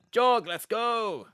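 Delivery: high, loud, slow call to the dog. Acted. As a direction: shout across the field for the dog. Calling the dog in character.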